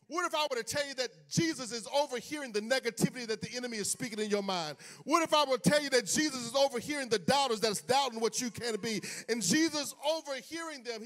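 rising, rhythmic preacher's call; full-force sermon delivery; preaching. Acted. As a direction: preach it out to the congregation. Preaching build.

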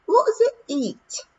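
a tiny Scottish pixie asking innocently; Daft Woolly's voice. Acted. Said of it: "Daft Woolly asked".

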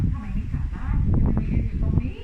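put-on loud, anxious questioning of the child; mother's worried question. Mother hugs her found son and questions him, loud worry. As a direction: call out to the lost son in relief and worry. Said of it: "วิ่งไปกอดลูก แล้วก็ถาม".

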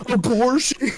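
high, loud scandalised squeal; mock shock. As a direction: squeal it in pretend horror. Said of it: "He'd be like".

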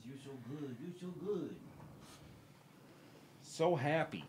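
high, hushed tender praise; soft praise. Real, not acted. Streamer praising his dogs, high hushed voice. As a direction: whisper praise to a pet.

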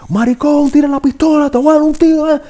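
first take, angry but careful; Spanish threat from a nervous first audition take; acted threat in Spanish. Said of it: "Can you do it in Spanish?".

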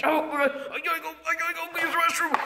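very fast, panicky babble; urgent rush. Acted. Character needing the restroom, frantic rushed babble. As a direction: blurt it out at top speed.

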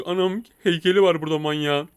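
ordinary delivery

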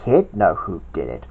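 ordinary delivery, nothing marked

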